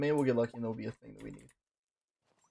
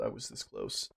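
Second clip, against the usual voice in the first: mock menacing low voice; 5 dB quieter than usual. Gamer menacing viewers after nearly losing it, voice dropped low. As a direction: voice dropped far lower, ominous and menacing. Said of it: "I sound like Richard Nixon".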